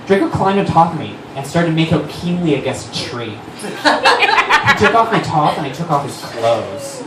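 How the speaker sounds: ordinary talk, nothing marked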